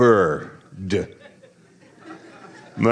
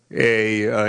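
ordinary talk, nothing marked

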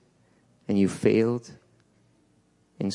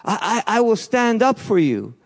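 higher, eager pledge to Jesus; hammed-up promise of loyalty; mock excited vow; 8 semitones higher than usual. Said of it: "I could be excited and say".

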